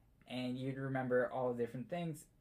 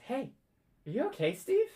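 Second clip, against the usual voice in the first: put-on much higher concerned child voice; worried little girl; 11 semitones higher, 4 dB louder than usual. Halfling girl asking the hurt boy if he's okay, high child voice. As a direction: ask it like a caring little friend.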